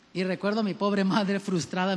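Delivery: normal talking voice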